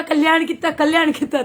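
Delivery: loud, high repeated call. Retold or imitated. Guruji calling out loudly.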